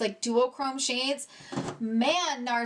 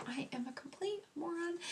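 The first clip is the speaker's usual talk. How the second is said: very soft, higher self-reproach; self-mocking; 5 semitones higher, 11 dB quieter than usual. Real, not acted. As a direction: quietly own up to the blunder. Scolds herself after finding lipstick.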